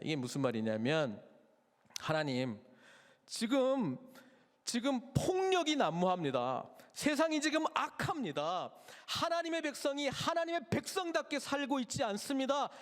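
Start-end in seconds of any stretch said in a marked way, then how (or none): 2.0-12.7 higher, urgent complaint to God; crying out to God. Acted. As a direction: plead for justice, voice rising. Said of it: "이렇게 외치고 있는데".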